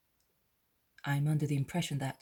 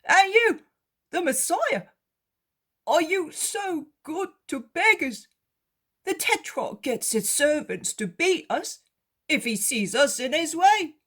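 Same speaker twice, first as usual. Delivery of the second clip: much higher, louder beggar voice; disbelieving that the Messiah cares for beggars; beggar, incredulous; 13 semitones higher, 8 dB louder than usual. Acted.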